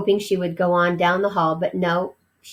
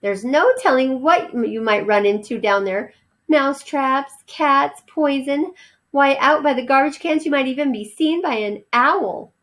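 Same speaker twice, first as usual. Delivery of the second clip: worried mother mouse; 6 semitones higher than usual. Acted. Mother warns of dangers.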